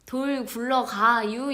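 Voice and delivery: slow, drawled Chungcheong dialect line. Retold or imitated. Quoting an old Chungcheong saying, slow Chungcheong dialect. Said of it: "이거는 진짜 옛날".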